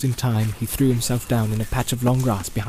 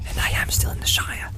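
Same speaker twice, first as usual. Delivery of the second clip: unvoiced inner thought; whispered thought. A narrator's reading. Frodo's private thought, whispered. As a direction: breathe it to yourself. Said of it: "he thought".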